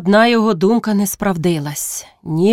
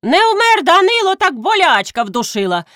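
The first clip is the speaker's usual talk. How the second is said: mocking villager; 11 semitones higher than usual. A narrator's reading.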